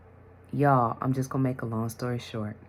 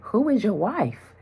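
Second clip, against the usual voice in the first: surprised question; 9 semitones higher, 6 dB louder than usual. Retold or imitated. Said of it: "and she said to him".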